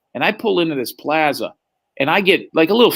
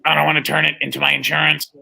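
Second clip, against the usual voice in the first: electrolarynx voice; faster than usual. Retold or imitated. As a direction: imitate a man speaking through a throat device. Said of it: "he, in his voice".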